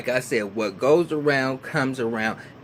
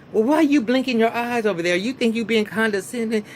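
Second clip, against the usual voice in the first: imitating an indignant accusation; 9 semitones higher, 3 dB louder than usual. Retold argument, Miss Pat accuses.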